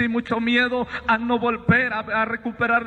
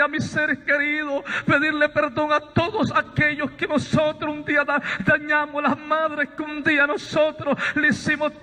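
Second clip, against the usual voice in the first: higher, impassioned preaching of the embrace; fervent testimony; 4 semitones higher than usual. Acted. Preacher telling brothers of his vision, impassioned and raised. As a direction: carry the congregation up with mounting fervor.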